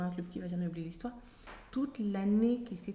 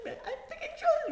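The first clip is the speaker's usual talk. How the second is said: laughing plea. Retold or imitated. Pleading with her mother, laughing.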